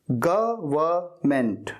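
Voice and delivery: "गवर्नमेंट" slowly split as guh, vuh, ment; syllable by syllable. Shown as a demonstration. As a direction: sound out "गवर्नमेंट" in slow parts. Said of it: "क्या कहना है?".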